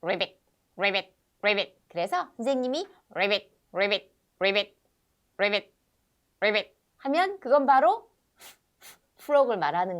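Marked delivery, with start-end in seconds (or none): 0.0-1.8 low, short croaked ribbits; frog sound imitation. Shown as a demonstration. Teacher demonstrating how English speakers imitate frogs, frog croak. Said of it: "흉내 내는 개구리 소리". 3.7-5.7 low, short croaked ribbits; frog sound imitation. Shown as a demonstration. Teacher demonstrating how English speakers imitate frogs, frog croak. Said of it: "흉내 내는 개구리 소리".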